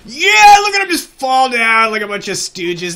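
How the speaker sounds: loud, high gloating yell; triumphant shout. Real, not acted. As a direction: crow over the fallen enemies. Triumphant yell as enemies fall.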